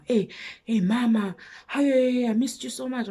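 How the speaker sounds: put-on showy drunk greeting. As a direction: imitate him putting on a show. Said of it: "he's putting a show now".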